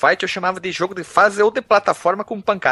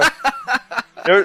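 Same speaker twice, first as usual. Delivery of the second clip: laughing; 5 semitones higher than usual. Real, not acted. Laughing at the Mega Man joke.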